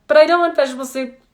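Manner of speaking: imitating a whiny child; whining child. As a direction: rattle it off like a complaining kid. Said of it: "And the whining and the crying".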